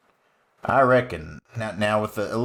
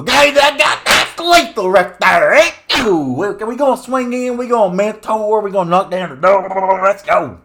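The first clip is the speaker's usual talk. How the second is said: much higher and louder, hollering; triumphant cheering; 9 semitones higher, 7 dB louder than usual. Acted. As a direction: whoop it up, the win is here. Cheering his lethal turn.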